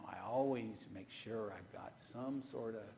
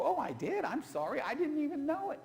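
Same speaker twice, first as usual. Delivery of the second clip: surprised apology; 12 semitones higher, 8 dB louder than usual. Retold or imitated. Husband apologizing, retold quarrel.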